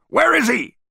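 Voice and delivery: very high, loud demand; screaming. A narrator's reading. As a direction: scream the question. Spyro screams at bodyguards.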